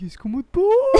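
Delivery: mimicking a mother's very high scolding tone; hyperactive mom. Co-host copies the storyteller's mom, in a high hyperactive tone. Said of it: "ये मेरी mummy की hyper active tone है".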